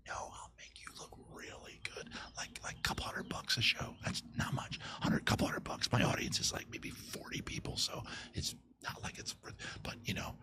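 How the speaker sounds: whispered aside to the audience; conspiratorial whisper. Real, not acted. Host whispering a sponsorship pitch to brewers. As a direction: hush it like a secret deal.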